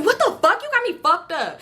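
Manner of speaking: high, offended outburst; mock-outraged comeback; indignant retort. Indignant retort to drug offer.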